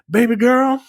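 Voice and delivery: butter her up like a scheming dad; a dying father sweet-talking his daughter; mock fond father. Voicing father in retold scene.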